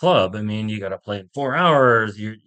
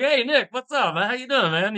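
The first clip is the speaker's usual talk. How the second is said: casual mock greeting; 11 semitones higher, 4 dB quieter than usual.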